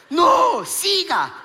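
high, loud acted exhortation; urgent urging. Husband urging exhausted wife onward, mock desperate yell.

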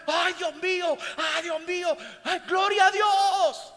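high, loud ecstatic cries, building to the last; shouting praise. Acted. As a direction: cry out to God, overjoyed. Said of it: "Y comienza a gritar". (gritos eufóricos de alabanza)

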